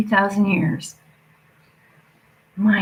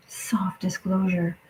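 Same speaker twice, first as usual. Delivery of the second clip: slow conspiratorial whisper; slow hushed secret; 5 dB quieter, slower than usual. Real, not acted. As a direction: lean in and whisper it slowly. Secretive aside.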